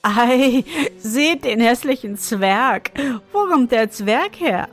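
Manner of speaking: jeering crowd calling out. Acted. Townsfolk jeer at the dwarf in the street, mocking calls. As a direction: shout the taunts at the odd little dwarf. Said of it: "Überall hörte er rufen".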